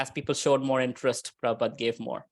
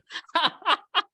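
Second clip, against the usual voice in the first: bright, rising laugh; high laugh; 12 semitones higher than usual. Real, not acted. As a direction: laugh at the joke. Laughs at the joke.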